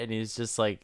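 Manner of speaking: ordinary talk, nothing marked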